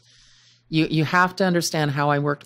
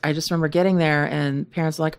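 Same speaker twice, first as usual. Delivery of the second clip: normal talking voice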